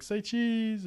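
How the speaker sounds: high-pitched mimicked camera call; picture-taker's call. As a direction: imitate a relative calling for the pose. Relatives calling out to pose.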